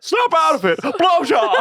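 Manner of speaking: high, shouted mock slap line; hammed-up high shout.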